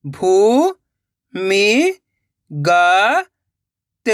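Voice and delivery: long drawn-out syllables with pauses between. Shown as a demonstration. Teacher sounding out a word for learners, syllable by syllable. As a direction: sound out each syllable slowly and separately. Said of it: "एक एक अक्षर का उच्चारण करके".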